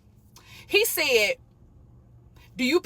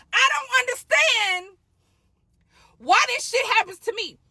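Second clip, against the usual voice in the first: mock despair; 6 semitones higher than usual. Real, not acted. Despairing complaint.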